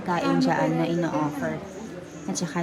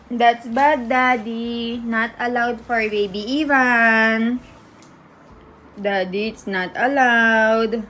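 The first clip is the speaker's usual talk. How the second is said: playful scolding; 3 semitones higher, 4 dB louder, slower than usual. Real, not acted. Mock scolding daddy for baby. (pabirong pagsaway, mabagal at pahaba)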